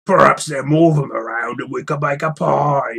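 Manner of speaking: a troll planning dinner. Acted.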